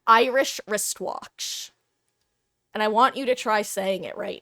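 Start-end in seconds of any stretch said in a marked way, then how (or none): 0.1-1.7 "Irish wristwatch" said slowly, each sound crisp; slow tongue twister. Shown as a demonstration. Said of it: "my favorite tongue twister".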